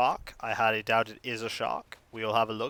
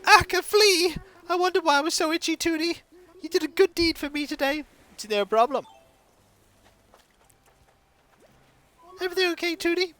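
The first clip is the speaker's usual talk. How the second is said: much higher, louder villager; 3 dB louder than usual. Acted. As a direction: squeal the villager's lines up high. Voices game villager lines.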